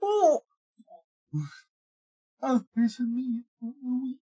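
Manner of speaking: high, hammed-up Waluigi squeal. Shown as a demonstration. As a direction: go for the big Waluigi cry. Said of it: "That is one of the Waluigi impressions".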